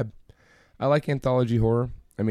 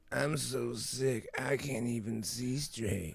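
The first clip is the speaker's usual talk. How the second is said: weak, slow whiny sick voice; mock sick voice; 11 dB quieter, much slower than usual. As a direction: drag it out like you're ill. Mocking cohost's sick episodes.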